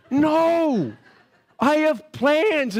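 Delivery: high, drawn-out indignant refusal; exaggerated, dragged-out objection; mock protest. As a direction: whine it in hammed-up outrage. Acted protest to his wife.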